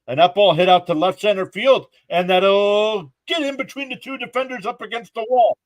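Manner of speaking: high, loud live baseball call; excited play-by-play. Acted. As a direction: call the hit like a broadcaster.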